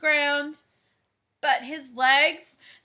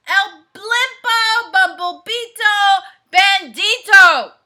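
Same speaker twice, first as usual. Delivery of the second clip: dramatic announcement; 4 semitones higher, 10 dB louder than usual. Acted. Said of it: "whispered the crowd".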